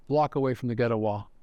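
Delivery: normal talking voice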